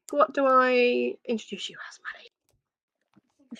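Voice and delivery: breathy butler whisper. Acted. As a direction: lean in and whisper it.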